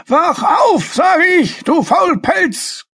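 put-on high, loud, slow cranky old man yell. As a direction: bellow at the lazy dragon like a furious old wizard. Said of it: "gellte der alte Mann".

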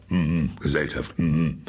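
a lightly voiced badger grunting "Mhm". A narrator's reading. The badger grunts at the picnickers, lightly voiced. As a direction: grumble like a gruff badger who dislikes company. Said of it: "dann grunzte er".

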